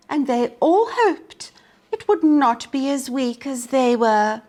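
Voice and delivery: usual speaking voice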